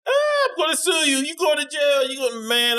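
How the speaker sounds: high, wailing mock cry that fades toward the end; imitating the injured man. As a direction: whine the threats from the ground. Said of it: "talking about".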